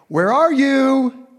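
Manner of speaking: high, slow, loud sing-song call across the garden; playful calling out. Acted. God calling playfully to Adam and Eve, high loud call. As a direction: call out like a father playing hide and seek.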